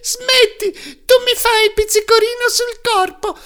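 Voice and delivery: very high, loud ticklish laugh-talk; a wooden log's high, tickled giggle; tiny giggling voice. Acted. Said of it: "gli disse ridendo".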